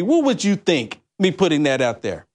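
usual speaking voice

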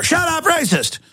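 high-pitched sneering mock shout; mocking accuser. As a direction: squeal it like a hysterical accuser.

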